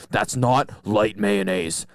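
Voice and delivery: slow, low Southern drawl; Southern accent. Retold or imitated. Customer ordering the sandwich remade, slow Southern accent. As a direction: stretch the words like a stern Southerner. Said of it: "why did he become a Southerner".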